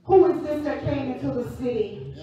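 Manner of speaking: loud, sung-out preacher cadence; preaching at full force. Acted. Preacher rousing the congregation, loud sung-out cadence. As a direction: lift the church with rising, chanted preaching.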